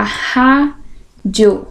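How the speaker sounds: "rádio" slowly split in two; syllable by syllable. Shown as a demonstration. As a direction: pause between the syllables of "rádio". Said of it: "Então, olha lá".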